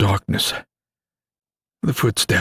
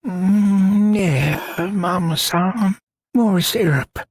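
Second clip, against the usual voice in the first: higher, slow half-asleep mumble; drowsy dreaming voice, pitched up high; sleepy grumble; 12 semitones higher, much slower than usual. A narrator's reading. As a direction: talk in your sleep, dreaming of breakfast. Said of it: "Eli grumbled".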